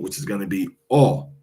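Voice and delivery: ordinary talk, nothing marked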